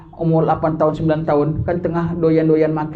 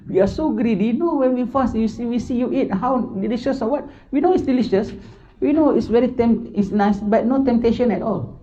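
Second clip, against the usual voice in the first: quoting a friend in English; 6 semitones higher than usual. Retold or imitated. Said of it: "Dia bilang".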